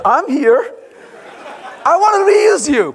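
laughing voice for the code; playful mock voice. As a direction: voice the code as a pleading little character. Method answering the developer.

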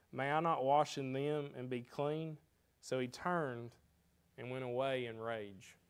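loud, deliberate Bible reading voice; scripture reading. Acted. Preacher reading the Naaman passage to his church, solemn and loud. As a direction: proclaim the old verses to the congregation.

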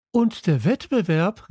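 high interrupting call. A narrator's reading. Aydin interrupts the teachers with a high call. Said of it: "rief Aydin dazwischen".

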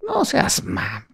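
high, soft mock scold. Teacher teasing students who say Pedro the English way, high mock scold. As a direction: squeak a joking scold.

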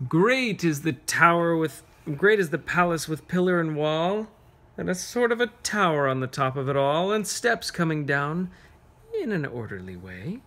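lilting rhythmic verse, leaning on each "great"; reading a poem to children. Acted. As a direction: savor the grand palace line by line.

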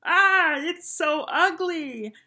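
high, loud, drawn-out mock horror; shrieking schoolkid taunt; yelling. A narrator's reading. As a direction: yell in exaggerated disgust. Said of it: "yelled his skinny friend".

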